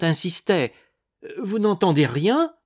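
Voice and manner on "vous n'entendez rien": frightened. A narrator's reading. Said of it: "toute tremblante".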